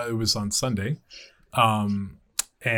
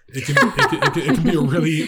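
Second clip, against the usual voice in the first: words breaking into laughter; laughing; 6 semitones higher, 5 dB louder than usual. Real, not acted. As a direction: laugh through the line. Laughing through the sentence.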